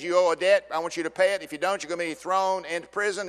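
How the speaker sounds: loud, firm royal demand; stern king demand. Acted. King to the debtor, loud stern demand. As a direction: lay the debt on him hard.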